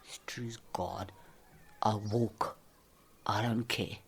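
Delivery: whispered, low. Real, not acted. Woman whispers her defiance to herself. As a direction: hush the defiance.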